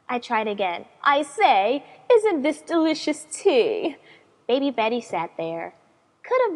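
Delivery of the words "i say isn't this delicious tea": proper lady voice. Acted. Retrying the proper tea talk.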